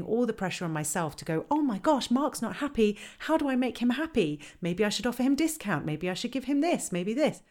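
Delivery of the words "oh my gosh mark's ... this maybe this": high, anxious fretting; flustered worrying to yourself, pitched up; mock panic over an unhappy customer; panicked inner voice. Voicing panicked inner monologue.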